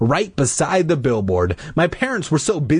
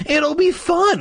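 put-on high-pitched eager child; declaring. A narrator's reading. Boy reassuring Mom, excitedly declaring. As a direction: announce it brightly, way up high. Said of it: "Luke declared".

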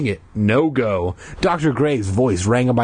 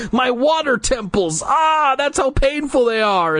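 hammed-up pain; 12 semitones higher than usual.

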